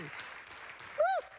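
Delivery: high-pitched "woo"; excited whoop. Real, not acted. Preacher rousing the congregation, excited whoop. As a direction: let out a high little whoop.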